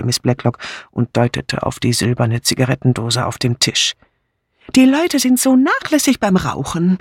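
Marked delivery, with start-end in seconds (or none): 4.7-7.0 high-pitched, fussy disapproval; disapproving. A narrator's reading. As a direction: complain in a high voice. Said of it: "bemerkte Dora Banner missbilligend". (missbilligend, hohe Stimme)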